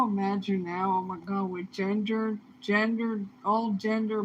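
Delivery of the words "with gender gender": ordinary talk, nothing marked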